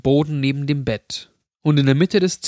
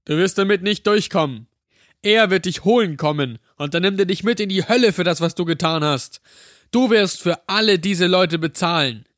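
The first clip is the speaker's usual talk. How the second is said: raised, higher-pitched shouted threat; shouting curse; 5 semitones higher than usual. A narrator's reading. Rottenbacher shouts threats after the fleeing pair, raised voice. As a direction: call out damnation after them. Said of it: "hörte ich Rottenbacher schreien".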